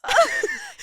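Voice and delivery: squealing laugh; high-pitched giggle. Real, not acted. Laughs at fan story.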